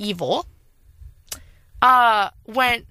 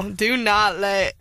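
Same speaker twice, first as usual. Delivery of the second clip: mock warning; 2 semitones lower, slower than usual. Real, not acted. Mock warning about angry mom.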